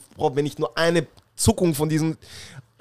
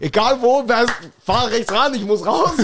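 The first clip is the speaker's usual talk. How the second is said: louder, higher, slow panicked plea; retold panicked yell; 6 semitones higher, 4 dB louder than usual. Teen retelling the car spider, half-mock panicked yell. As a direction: beg to pull over in a half-mock panic. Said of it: "ich fang an zu schreien".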